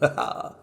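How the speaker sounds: a quick, airless, self-satisfied chuckle; soft breathy gloating laugh. Acted. Murderer chuckling to himself over the hidden body, breathy laugh. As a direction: laugh under the breath at your own cleverness. Narrator proud of hidden corpse.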